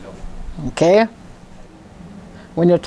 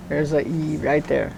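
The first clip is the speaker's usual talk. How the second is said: ordinary talk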